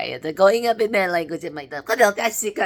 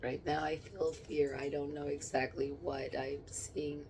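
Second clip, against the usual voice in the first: ordinary talk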